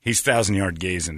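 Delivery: ordinary talk, nothing marked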